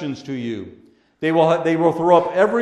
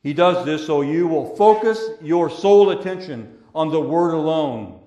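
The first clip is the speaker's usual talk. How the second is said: normal talking voice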